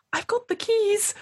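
very high, slow gleeful squeal; triumphant announcement. Acted. GM voices Miss Alston holding up the keys. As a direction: announce the keys like a proud little victory. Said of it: "Miss Alston's like".

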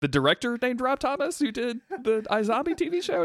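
high-pitched baffled query; incredulous guessing. Retold or imitated. Sibling guesses at sister's horror director, high and incredulous. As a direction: pitch it way up in disbelief.

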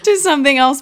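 breaking into a tearful laugh; laughing to keep from crying; close to crying. Real, not acted. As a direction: laugh it off through real tears, pitch jumping up. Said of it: "before I start crying".

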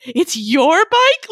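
high, drawn-out mock amazement; amazed excitement. Retelling Evan learning about the bike, amazed excitement. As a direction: imitate a thrilled, disbelieving squeal.

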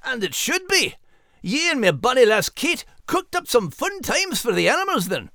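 high Scottish-pirate lad voice; fond, proud of his lass; Max voice. Acted. Max praises Kate's story, high Scottish lilt.

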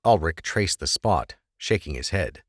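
ordinary delivery

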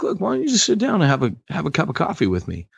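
high, fast and eager invitation; friendly invitation. Retold or imitated. Minister inviting a Klan member to coffee, fast and high. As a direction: coax him to sit down. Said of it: "And he'd be like".

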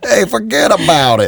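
mock New York tough guy; New York accent. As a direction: toss it off like a New Yorker. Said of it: "New York accent".

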